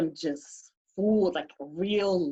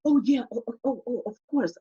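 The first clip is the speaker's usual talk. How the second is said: embarrassed; 4 semitones higher, faster than usual. Retold or imitated. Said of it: "he's so embarrassed".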